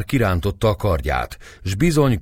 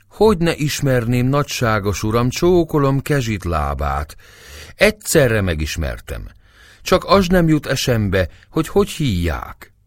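higher-pitched, obsequious character voice; 6 semitones higher than usual. Acted. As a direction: play a humble, flattering old acquaintance.

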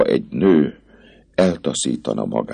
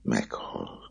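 much softer, frozen Meghalt; the girl echoes him in a frozen voice; icy; 6 semitones lower, 12 dB quieter than usual. Acted. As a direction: let Meghalt drop dead and cold.

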